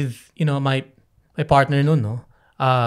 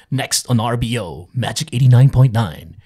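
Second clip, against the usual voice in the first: louder radio jingle read; radio station ID; 3 semitones lower, 5 dB louder than usual. Retold or imitated. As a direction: punch out the station ID like a DJ. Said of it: "yung narinig ko kunyari".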